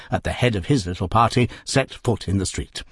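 plain reading voice

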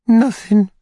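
high, loud falsetto answer; distressed lady. A narrator's reading. Matron answers the beadle's inquiry, high plaintive voice.

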